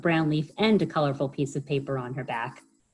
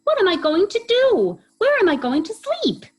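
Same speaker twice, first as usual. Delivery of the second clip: very high, louder wailing questions; despairing Hedgehog; 8 dB louder than usual. Acted. Hedgehog despairing where to sleep, high wail. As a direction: wail in despair over her lost bed.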